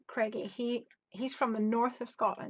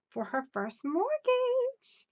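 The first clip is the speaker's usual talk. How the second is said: excited; 14 semitones higher, slower than usual. Real, not acted. Reading viewer's big news.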